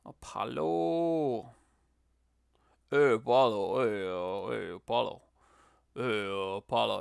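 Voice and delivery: slurred, mumbled call of a lightly done boxer; Rocky Balboa impression. Streamer doing Rocky for the chat, a light mumbling boxer. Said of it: "That's my Rocky Balboa impression right there".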